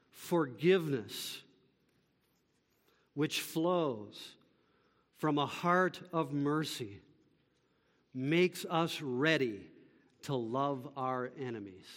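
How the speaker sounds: low, soft, measured phrases with long pauses; solemn summary. Real, not acted. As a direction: let each phrase sink in, hushed and deliberate. Solemn one sentence summary, slowly delivered.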